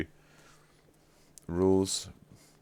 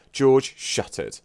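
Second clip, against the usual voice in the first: mock stern; 5 semitones higher, 5 dB louder, slower than usual. Real, not acted. Tells George to stop.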